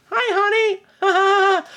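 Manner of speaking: high, sugary mock endearment; mock sweet. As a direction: coo it like a fake sweetheart. Teasing absent co-host Bradford.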